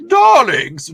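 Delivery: high, loud, drawn-out "Darlings"; mock-affected darling. Teasing the guests while Ian's away, mock-affected. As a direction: call it out with playful grandeur.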